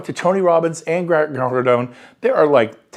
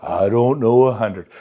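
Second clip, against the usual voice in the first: a hint of sad donkey; mopey new agent excuse; Eeyore mope; 6 semitones lower than usual. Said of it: "You're going all Eeyore on me".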